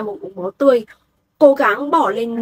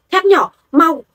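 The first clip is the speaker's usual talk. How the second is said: higher, louder urgent command; urgent cry; 5 semitones higher, 5 dB louder, slower than usual. A narrator's reading. Father in panic orders his weapon to hurry, urgent louder call. Father senses daughter in danger.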